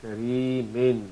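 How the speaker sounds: kareemin stretched slowly, the final n clear; slow demo word. Shown as a demonstration. Teacher modelling kareemin slowly for students. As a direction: draw out kareemin, closing on the noon. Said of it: "We'll pronounce it like this".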